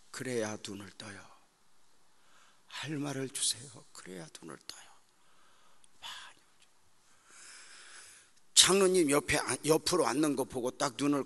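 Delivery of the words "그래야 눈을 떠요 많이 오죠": soft whispered aside. Real, not acted. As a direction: drop into a whisper. Aside after prayer. (속삭이듯)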